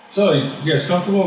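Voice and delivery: casual therapist put on high; imitating the therapist.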